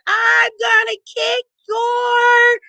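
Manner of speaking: high, drawn-out mock yell; retold holler. Wife hollering at husband from the ride, mock yell. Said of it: "I hollered at my husband".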